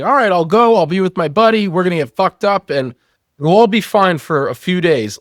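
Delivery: mock breezy confidence, talking yourself into it; breezy self-assurance. Man retells his naive plan for the trip, breezy.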